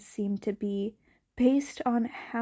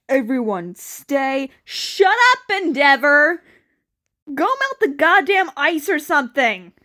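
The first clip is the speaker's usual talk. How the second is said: loud, raised barking orders; angry shouting over a crowd; 3 semitones higher, 9 dB louder than usual. Acted.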